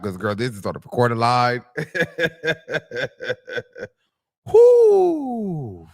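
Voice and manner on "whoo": a high, loud whoop; loud whoo. Real, not acted. Vlogger whooping while cracking up, loud. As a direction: let out a big whoop of delight. Reacting loudly after laughing fit.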